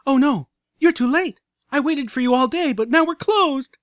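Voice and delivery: high, loud, pretend dismay; mock horror. A narrator's reading. Filly pretends the shop has closed, hammed-up horror. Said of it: "gasping as if in horror".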